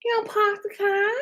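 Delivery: high-pitched mock Boston accent. Streamer quoting the self-parking car commercial, hammed-up Boston accent. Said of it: "I kept talking like that the whole summer".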